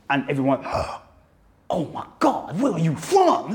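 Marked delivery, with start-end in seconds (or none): 1.7-3.5 gasping, high-pitched astonishment; imitated awestruck American reaction; mock amazement. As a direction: act out a starstruck American onlooker. Imitating amazed New Yorkers hearing his accent.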